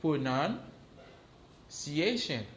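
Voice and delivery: slow, drawn-out Pronunciation; teacher modelling Pronunciation slowly; slow model word. Shown as a demonstration. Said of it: "Repete apre m konnen".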